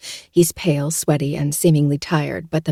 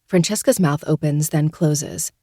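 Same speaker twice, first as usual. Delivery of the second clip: ordinary delivery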